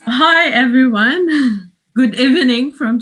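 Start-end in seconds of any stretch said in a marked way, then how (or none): none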